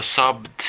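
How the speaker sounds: "sobbed" ending in a hard voiceless t, sobt; wrong final sound. Shown as a demonstration. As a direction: close "sobbed" on a sharp "t". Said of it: "ni digo sobbed".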